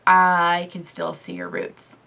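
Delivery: slow, unhurried restating of his line; boyfriend's remark repeated slowly; slow repeated quote. Retold or imitated. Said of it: "he's like".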